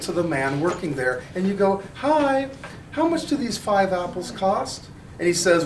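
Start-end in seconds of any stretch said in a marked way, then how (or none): 2.0-4.9 high, chirpy shopper asking the price; polite customer. Acted. A customer asks the Trader Joe's clerk the price, high and polite. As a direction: sweetly ask the clerk what the apples cost.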